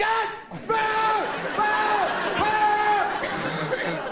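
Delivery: high, loud panicked yells; mock screams. Comic acting out people screaming in a big quake. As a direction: scream like the ground is shaking.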